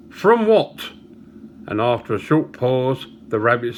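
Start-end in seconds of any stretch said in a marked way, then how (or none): 0.1-0.9 high-pitched shocked question; hammed-up, incredulous demand to know; mock astonishment. Barman asking how the rabbit died, mock astonished. Human asks cause of rabbit's death.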